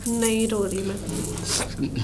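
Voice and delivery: low, slow, soft and tearful; drawn-out, subdued sob of a denial. Acted. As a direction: deny the crying through tears. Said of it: "Nandini, तुम रो रही हो?".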